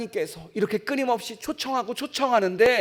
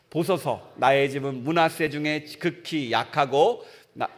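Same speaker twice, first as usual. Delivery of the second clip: lower, measured Bible reading; scripture reading; 4 semitones lower than usual. Acted. Preacher reading Gideon's answer to the congregation, solemn.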